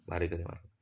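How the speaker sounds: ordinary delivery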